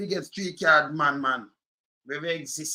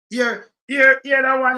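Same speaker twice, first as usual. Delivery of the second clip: raised voice, pointing someone out; loud insistent call; 6 semitones higher, 10 dB louder, slower than usual. Real, not acted. Calling out emphatically.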